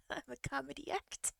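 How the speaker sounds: soft words through giggles; laughing while speaking. Retold or imitated. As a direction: giggle out the boast. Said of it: "I was just laughing so hard".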